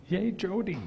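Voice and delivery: laughing cheer. Real, not acted. Cheering a fellow panelist.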